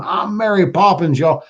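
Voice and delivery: high, proud Southern yell of I'm Mary Poppins; Southern accent. Pretend.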